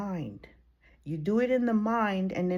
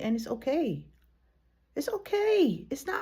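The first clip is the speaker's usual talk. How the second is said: ordinary talk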